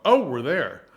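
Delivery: loud theatrical "Oh, we're there", "Oh" falling; bright commedia surprise at arriving; exaggerated arrival. Shown as a demonstration. Teacher shows students the commedia journey: loud staged surprise at arriving. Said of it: "And it's a gesture".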